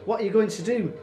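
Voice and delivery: high, loud, anxious question; alarmed mother. A narrator's reading. Mother fears for her captured son, alarmed. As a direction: let dread lift the voice sharply.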